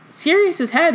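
shocked repeat to Harry; surprised. A narrator's reading. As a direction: blurt it out in disbelief. Hermione startled by Harry's claim.

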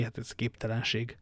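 usual speaking voice

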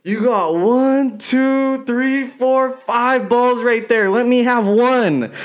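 put-on high, slow, slurry drunk begging; mock a wasted fan asking for a ball; drunk buddy voice. Friend imitating drunk Noah begging a player for a ball. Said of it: "he's like".

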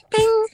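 high chirpy ding; mock alert chime. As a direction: chirp it like a notification. Imitating an email alert.

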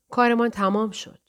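plain reading voice

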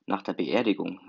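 usual speaking voice

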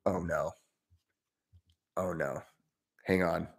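host mutters while a gadget misfires, hushed; muttered aside. Real, not acted. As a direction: mutter to yourself as something goes wrong. Muttering off mic.

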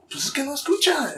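high-pitched quoted excuse; imitated high-voiced protest; retold protest to mom. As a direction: plead your case in a small, high voice. Quotes own reply to mother.

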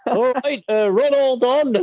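high, hammed-up Bristolian accent; bad Bristolian impression, laughing through it. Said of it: "you need to do the accent".

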